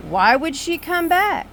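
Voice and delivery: mock know-it-all. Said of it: "To which he responds".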